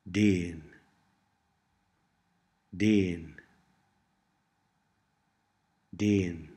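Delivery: déan with a long, continental e. Shown as a demonstration. Tutor models Cornish for learners, déan with long e. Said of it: "imitate the pronunciation as closely as possible".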